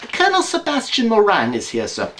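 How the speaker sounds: high, prim housekeeper's announcement; a high maid's voice at the door; housekeeper announcing. Acted. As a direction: announce the guest like a proper maid.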